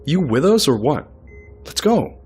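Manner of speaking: high, loud, impatient prodding; impatient shout. Acted. Doctor prods a staring colleague, impatient shout. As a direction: hurry a distracted colleague along loudly.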